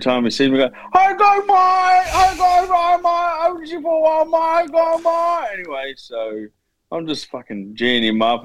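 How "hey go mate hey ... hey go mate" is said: much higher and louder, excited mock shouting of mate; hammed-up cheerful coworker hollering his greeting; exaggerated shouted impression of a workmate; imitating coworker. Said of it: "the Indian guy at work".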